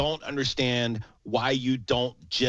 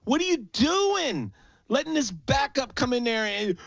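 heated rant, pitched higher, building to a shout; real anger; 7 semitones higher than usual. Real, not acted. Fed-up fan ranting to his co-host about the defense, real heated anger. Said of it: "I'm so mad".

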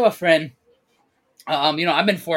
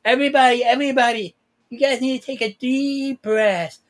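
imitating a toddler; 7 semitones higher than usual. Nephew telling the yelling family to calm down, mock child voice. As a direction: play a three-year-old telling grown-ups to breathe. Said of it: "in my family yelling".

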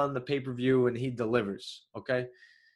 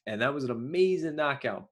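ordinary talk

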